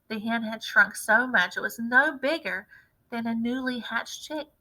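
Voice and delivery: ordinary delivery, nothing marked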